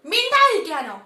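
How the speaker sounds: loud high roar. Acted. Ajit roaring back at Nomita, loud roar. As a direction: bellow the question. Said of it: "Ajith അലറി".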